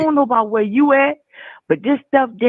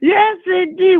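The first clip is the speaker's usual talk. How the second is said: laughing; 7 semitones higher, 4 dB louder, slower than usual. Real, not acted. Big laugh.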